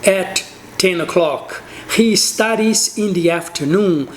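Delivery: slow, careful example for students; slow model sentence. Shown as a demonstration. Teacher models third person sentences, slowly. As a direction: spell it out slowly so learners follow. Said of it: "Então, você vai falar".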